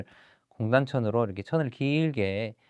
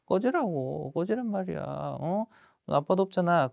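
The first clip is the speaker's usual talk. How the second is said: higher-pitched, lightly needling bully voice; taunting bully; 5 semitones higher than usual. Acted. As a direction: keep provoking him without raising volume. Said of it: "계속 제가 자극을 줍니다".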